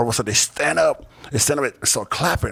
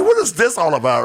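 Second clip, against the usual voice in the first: higher, louder baffled outburst; reliving his disbelief at the band's song; 8 semitones higher, 6 dB louder than usual. Retold or imitated. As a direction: throw the question out in amused disbelief.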